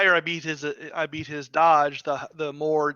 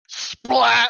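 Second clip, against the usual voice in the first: loud, high "splat" sound effect; imitated splat; 8 semitones higher, 8 dB louder than usual. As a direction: shout the impact like a cartoon noise. Player voices the hit, after laughing.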